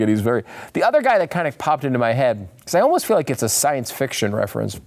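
ordinary delivery

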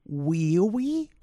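a hint of a timid, hopeful inmate; lightly voiced simple prisoner; Herbert Mullen. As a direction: ask it small and drawn out. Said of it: "And he's like".

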